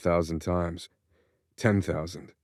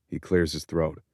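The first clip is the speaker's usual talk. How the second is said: normal talking voice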